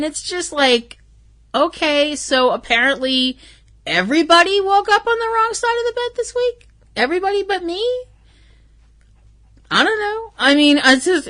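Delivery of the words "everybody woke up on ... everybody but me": higher, incredulous sarcastic question; sarcastic disbelief. Real, not acted. Vlogger sarcastically asking viewers about grumpy callers, incredulous. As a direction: ask it with mock bafflement.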